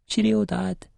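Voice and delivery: put-on a wee boy saying goodbye to his dad, high and bright; small boy. A narrator's reading. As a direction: chirp it like a little boy. Small child voice.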